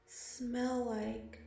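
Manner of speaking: usual speaking voice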